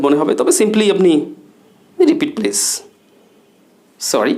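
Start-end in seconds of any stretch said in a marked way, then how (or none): 2.0-4.3 questioning. Shown as a demonstration. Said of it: "চোখে মুখে একটা কোশ্চেনের এক্সপ্রেশন".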